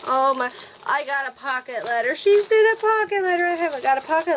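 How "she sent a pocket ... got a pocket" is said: high, loud and fast, thrilled to find it; excited squeal. Real, not acted. Vlogger finds her first pocket letter, thrilled and fast. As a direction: blurt it out in a rush of delight.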